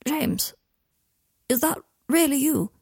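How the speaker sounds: half-awake, straining to speak; rasping voice. Acted. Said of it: "Harry was woken up by Draco's rasping voice".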